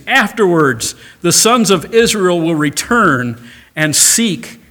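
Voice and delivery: loud, high preaching, slowing at the end; fervent pulpit delivery; full-force preaching. Real, not acted.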